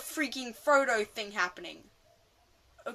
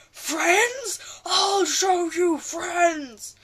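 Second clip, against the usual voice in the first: high, loud, slow hammed-up dragon shout; mock Smaug roar; 8 semitones higher, 11 dB louder, much slower than usual. Retelling Smaug flying off in the film, hammed-up roaring shout. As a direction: ham up the angry dragon bellowing. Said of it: "and he's like".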